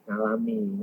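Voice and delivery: normal talking voice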